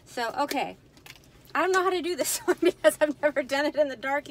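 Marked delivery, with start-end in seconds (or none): 1.5-4.3 frustrated laugh. Real, not acted. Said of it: "I'm getting frustrated".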